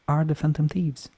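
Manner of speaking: ordinary talk, nothing marked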